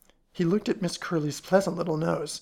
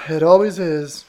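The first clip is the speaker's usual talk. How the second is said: loud, heavy gloom; gloomy; 12 dB louder than usual. A narrator's reading. Mister Queen lamenting his correct guess, gloomily. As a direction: intone it with mock doom. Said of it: "in a gloomy voice".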